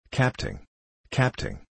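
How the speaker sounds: lightly acted cry for the captain; mild yell. A narrator's reading. Bumpkin running to the officer for help, mild yell. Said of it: "yelled the bumpkin".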